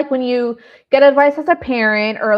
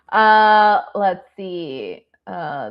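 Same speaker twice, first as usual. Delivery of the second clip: normal talking voice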